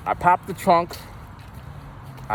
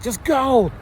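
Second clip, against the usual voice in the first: high, urgent send-off; raised-pitch retold command; urgent urging; 11 semitones higher than usual. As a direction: urge them to go, pitched way up.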